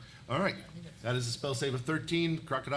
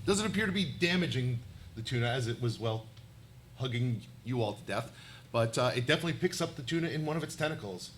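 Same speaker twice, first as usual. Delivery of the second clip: ordinary talk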